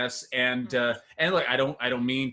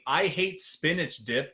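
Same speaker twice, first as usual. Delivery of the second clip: ordinary talk, nothing marked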